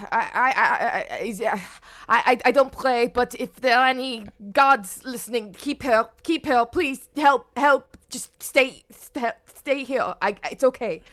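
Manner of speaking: loud, pleading prayer to any gods; desperate prayer. Acted. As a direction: beg the gods, voice straining. Uncle desperately prays aloud.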